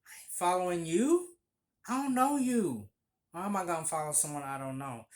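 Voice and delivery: outraged at a stranger; refusing. Retold or imitated. Narrator refusing to follow a stranger, loud and indignant. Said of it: "I was like".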